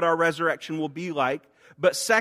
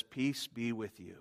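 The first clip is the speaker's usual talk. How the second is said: softer, slow tender blessing; tenderly; 6 semitones lower, 12 dB quieter than usual. Acted. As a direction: comfort them with each slow word. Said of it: "He comes to them tenderly".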